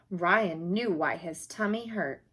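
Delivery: plain reading voice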